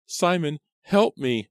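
high, loud panicked plea; a girl screaming for her brother as the axe comes; terrified cry for help. A narrator's reading. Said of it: "Elizabeth cried".

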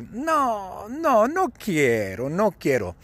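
high, drawn-out mock refusal; negativa quejosa. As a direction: whine the refusal, starting high and sliding down. [name] refuses Jody's plea. (negativa aguda y quejosa)

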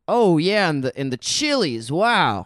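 hammed-up food host savouring a bite; Guy Fieri. Food show host finding something to praise, hammed-up relish. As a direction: swoop up on the exclamations, really appreciative. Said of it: "the way he talks about food".

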